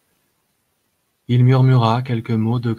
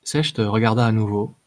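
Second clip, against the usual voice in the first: plain reading voice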